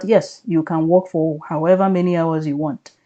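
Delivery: usual speaking voice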